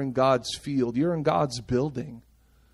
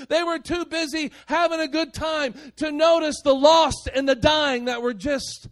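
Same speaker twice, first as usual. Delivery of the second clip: high, driving sermon delivery; impassioned preaching; 12 semitones higher than usual. Acted. Preacher telling the church about the cruise ship dream, impassioned. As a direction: preach it high, piling up the phrases.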